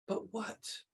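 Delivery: a puzzled whisper to herself; whispered wondering. Acted. Old Miss Rumphius puzzling over her last task, whispered. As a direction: breathe it as a private question.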